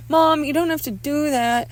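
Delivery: high, loud objection; protesting son. Acted. Son protests mother calling doctor, high loud voice.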